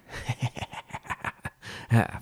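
soft, wheezy run of laughter; helpless giggle at your own punchline; laughing at own joke. Acted. Joke teller cracking up at own punchline, laughing.

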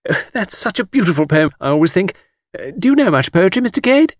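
high, fluting ladylike gush; gushing admirer. A narrator's reading. Miss Taylor asking Mr Cade about poetry, gushing. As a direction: coo over poetry in a high voice.

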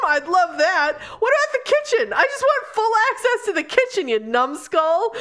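Christine scoffs at a library gift, pitch climbing as she builds to a high, laughing rant; mock indignant inner voice. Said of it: "Christine in her head was like".

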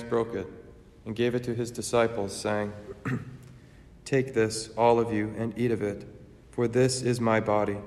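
measured, reverent altar prayer; solemn prayer. Acted. Eucharistic prayer and consecration.

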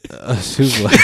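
loud, laughing repeat; laughing hard. Real, not acted. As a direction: crack up while saying it. Cracking up at the souffle joke.

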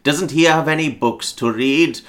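put-on a touch of puzzled old grandfather, drawn out; granddad. Granddad asking about his grandson, lightly acted and slow. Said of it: "the granddad's like".

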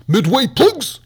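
high, loud jingle tag; sing-shout the show's plug; hammed-up promo jingle. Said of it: "That's the Midway plugs".

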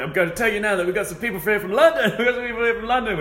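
hammed-up preacher calling out; mock preacher. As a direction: call out to the crowd like a preacher. Imitating preacher calling guests.

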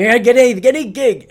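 desperate musicians grabbing any job; hammed-up eager plea.

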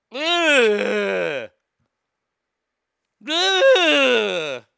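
long, drawn-out "ugh" of revulsion; disgusted groan. Real, not acted. Host revolted by the story, disgusted groan.

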